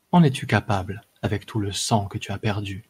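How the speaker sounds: plain reading voice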